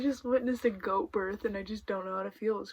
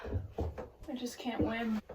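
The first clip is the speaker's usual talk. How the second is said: usual speaking voice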